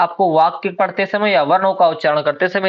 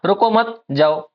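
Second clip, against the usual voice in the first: रुको मत as one slow phrase, then separate जाओ; comma after मत; slower than usual. Shown as a demonstration. Teacher asks what happened here, pause after मत. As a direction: hold a break between मत and जाओ. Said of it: "अर्थ का अनर्थ हो गया".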